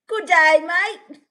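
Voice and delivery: loud, high put-on accent greeting; British character. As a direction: belt out a hammed-up British character hello. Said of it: "make the British character".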